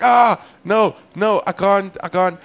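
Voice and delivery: high, loud flustered protest; shout, then back away from it; hammed-up helpless "I can't"; acted panic.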